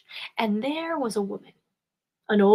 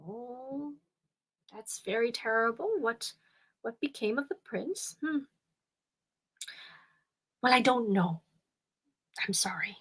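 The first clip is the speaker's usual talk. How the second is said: put-on much softer, sorrowful old woman, near whisper; hushed sorrow; 8 dB quieter than usual. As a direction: murmur it as if sharing bad news.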